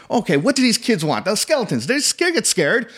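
mock flustered improvising, high and halting; flailing director. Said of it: "I see he's flailing. He's literally going".

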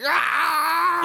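high, drawn-out mock scream; imitated henchman's cry. Showing the way he's like, high mock scream. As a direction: cry out high like the zapped villain. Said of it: "Even the way he's like".

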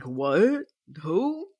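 puzzled, voice shooting up at the end; baffled what-who. Retold or imitated. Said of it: "who would be doing that".

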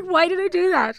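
loud, high self-reproach; mortified. Retold or imitated. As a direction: cry out in embarrassment. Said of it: "I was like".

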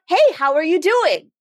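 high, loud-ish chirpy greeting; mock-cheery hello; over-friendly stranger. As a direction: imitate someone far too chummy. Imitates over-friendly doorstep stranger.

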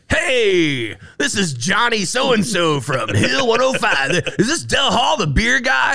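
high, loud, hyped-up radio DJ shout. Retold or imitated. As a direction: boom it out like a morning-show host. Radio DJ calling at dawn.

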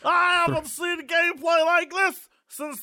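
put-on slow, shouted old announcer; baseball announcer. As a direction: call it like an old radio announcer.

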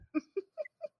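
high bursting laugh; laughing at herself. Real, not acted. Doctor laughing at her own blunt office remark, high laugh.

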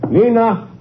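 high call up the stairs. Acted. Father calling his daughter down to the visitors, high call. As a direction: call her name to the next floor.

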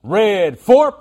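high, loud quarterback call with a twang; twangy snap count. Shown as a demonstration. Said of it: "I like my twang better".

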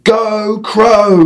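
high, loud cheering yell. Acted. Family cheering the crow from their seats, loud yell. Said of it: "we yelled".

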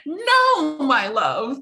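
No shot up very high, then my love sinks; melodramatic stage lover. Retold or imitated. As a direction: overwrought Shakespeare heroine. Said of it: "Romeo and Juliet Shakespeare play".

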